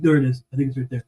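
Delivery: ordinary talk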